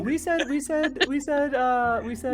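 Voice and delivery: long high squealed no; high-pitched protest. Real, not acted. Player protests game master's correction.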